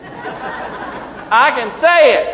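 loud, high, drawn-out exclamation; triumphant boast. Real, not acted. Preacher who finally got the word out, triumphant shout. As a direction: belt it out slowly, proud to manage it.

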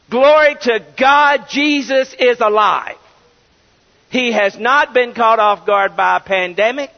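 loud, fast, building sermon proclamation; preaching climax. Acted. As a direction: preach it out with full conviction to the congregation.

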